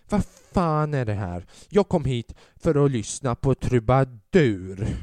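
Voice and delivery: put-on loud, slow outraged yell of an old lady. Comedian imitates the old lady yelling for a troubadour, loud slow yell. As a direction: bellow the complaint, dragging each word. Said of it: "den här damen skriker ut".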